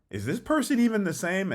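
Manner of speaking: high-pitched doubting question; puzzled disbelief; incredulous. Acted. Voicing a doubting friend's thought.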